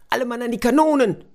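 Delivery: high, loud, fast order to the crew; captain rallies the crew, high and loud; captain's call. Acted. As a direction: bark the battle order. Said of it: "rief der Kapitän".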